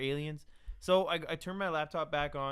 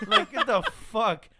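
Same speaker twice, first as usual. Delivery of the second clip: slow, louder, laughing get the fuck; 1 laughing disbelief; 4 semitones higher, 7 dB louder than usual. Real, not acted.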